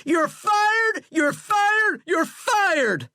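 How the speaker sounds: very high, loud, frantic screeches; repeated furious screech. A narrator's reading. President firing his general over and over, screeching. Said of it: "Flannery screeched".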